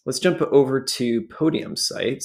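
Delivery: usual speaking voice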